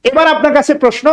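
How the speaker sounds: normal talking voice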